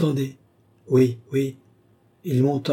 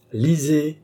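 even more commanding, higher; more imperative; 5 semitones higher than usual. A narrator's reading. Balsamo presses the medium to read, more imperiously. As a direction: push the order harder. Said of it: "reprit Balsamo d'un ton plus impératif encore".

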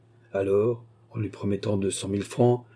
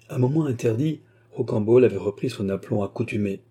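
ordinary delivery, nothing marked

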